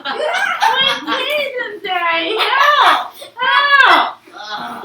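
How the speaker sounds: very high, loud, drawn-out cries; wailing plea. Acted. Stuck boy begs teasing Daddy, wailing. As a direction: wail for help in desperation.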